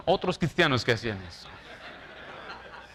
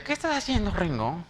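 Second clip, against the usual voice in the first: much higher supervisor voice; mock nagging boss; 4 semitones higher than usual. Pastor imitates his supervisor checking on him, higher nagging. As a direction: nag like the boss lady. Supervisor's question once more.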